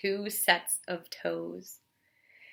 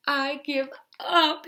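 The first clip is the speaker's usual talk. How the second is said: a touch of tearful giving up; higher and louder, close to tears; blubbering bear; 5 semitones higher, 7 dB louder than usual. Acted. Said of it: "he blubbered".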